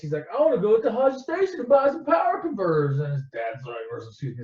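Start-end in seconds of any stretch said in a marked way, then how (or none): 0.3-3.0 put-on high, whining teenage complaint; whiny Luke. Luke begging his uncle to go to town, high whine. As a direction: whine it like a spoiled kid. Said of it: "Luke's a little whiny bitch".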